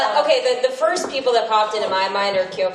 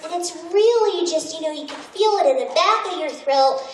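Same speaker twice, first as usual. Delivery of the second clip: speaking way up high; 8 semitones higher than usual. Shown as a demonstration. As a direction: push the voice way up into your head. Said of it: "speaking way up here".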